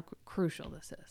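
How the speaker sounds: whispered stress on crucial; hushed emphasis. Real, not acted. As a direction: breathe the word crucial. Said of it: "emphatic hush".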